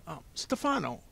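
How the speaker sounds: high-pitched, hesitant banker; fussy Mister Poe, hesitant. Acted. Banker starting to question Stefano, fussy and hesitant.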